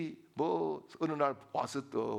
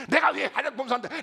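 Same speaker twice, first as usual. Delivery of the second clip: high, loud, rapid-fire challenge; hammed-up furious demand, pitched high; imitated angry outburst; 8 semitones higher, 8 dB louder, faster than usual. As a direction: act out a wife boiling over, fast and shrieked. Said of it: "아내가 화가 막 치밀어 올라가지고".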